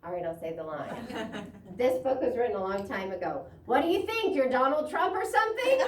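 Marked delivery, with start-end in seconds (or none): 3.6-5.8 high and loud mocking question; taunting voice from nowhere; the same shrill voice. A narrator's reading. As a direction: scoff at them, pitched way up. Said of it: "screamed a voice out of nowhere".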